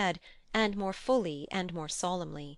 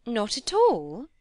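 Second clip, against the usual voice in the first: higher, louder, drawn-out question; cried with alacrity; 4 semitones higher, 8 dB louder, slower than usual. A narrator's reading. Miss Crawford seizing on Fanny's denial, with alacrity. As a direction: pounce on the answer, rising at the end. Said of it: "cried Miss Crawford with alacrity".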